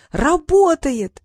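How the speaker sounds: high, drawn-out shout; excited cry. A narrator's reading. Gruya announcing the magic works, shouting. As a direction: stretch it into a long, loud cry. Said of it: "закричала Груя".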